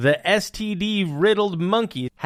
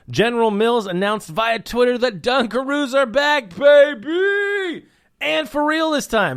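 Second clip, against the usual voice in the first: excited announcement; 7 semitones higher, 4 dB louder than usual. Acted. Announcing Dunkaroos return.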